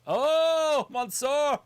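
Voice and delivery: high, loud surprised shout. Real, not acted. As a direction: call out the name in surprise. Surprise entrance during watched match.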